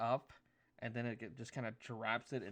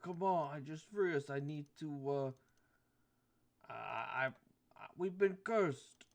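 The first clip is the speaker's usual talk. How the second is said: goat voice; 3 semitones higher, 4 dB louder than usual. Acted.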